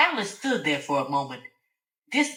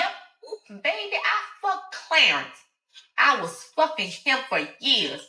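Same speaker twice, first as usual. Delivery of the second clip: high, gloating confession; smug Alice; 7 semitones higher than usual. Acted. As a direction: rub it in.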